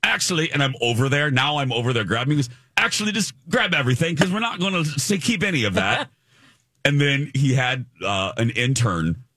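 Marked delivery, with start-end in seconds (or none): 0.0-0.5 put-on British accent. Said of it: "he was so dismissive". 2.8-6.1 fast, higher, lightly British and curt; dismissive British accent. Retold or imitated. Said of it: "he was so dismissive".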